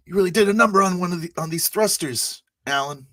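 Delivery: high, rapid in-character needling. Acted. Player teasing Alan about the thrusters, high character voice. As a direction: pitch it way up and rattle it off. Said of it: "It's like".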